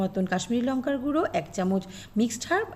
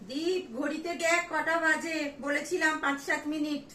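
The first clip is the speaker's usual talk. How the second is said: high, slow call across the house; calling kids to dinner; 5 semitones higher than usual. Acted. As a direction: call him to come eat. Calls Deep, food is ready.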